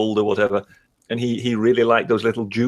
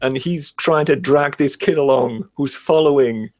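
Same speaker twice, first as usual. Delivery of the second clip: ordinary talk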